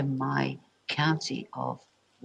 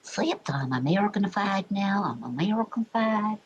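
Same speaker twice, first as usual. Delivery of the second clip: exaggerated American accent, pitched higher; 5 semitones higher than usual. Streamer tells viewers she's Americanified now, mock American accent. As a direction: put on a drawn American twang. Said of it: "I can do some accents".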